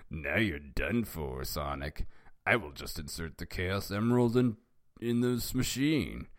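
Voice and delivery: hammed-up cartoon villain; Eggman's villain voice. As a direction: gloat in a put-on evil-genius voice. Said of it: "And then he said".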